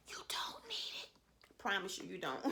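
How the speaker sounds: whisper. Real, not acted. Confiding aside to viewers.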